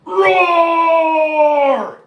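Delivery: long loud high roar; mighty lion roar. Acted. Lion showing off his roar, long and loud. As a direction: show off the big roar. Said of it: "listen to my mighty lion roar".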